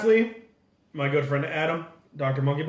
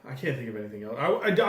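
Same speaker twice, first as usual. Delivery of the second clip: usual speaking voice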